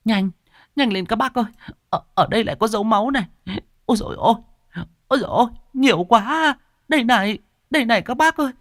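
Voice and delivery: high frantic woman's call; calling out loudly. A narrator's reading. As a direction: shout for help, frantic. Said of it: "chị Thắm đã kêu to".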